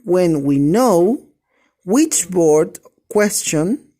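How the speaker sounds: We use the read slowly and deliberately; teacher reading a grammar rule slowly to the class; slow reading aloud. Shown as a demonstration. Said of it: "Acá dice".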